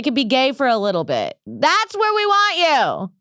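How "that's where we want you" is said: high, slow, drawn-out holler; hollered mock-protest finish; mock rally cry. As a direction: stretch it out like a slogan. Calling out mock political demand.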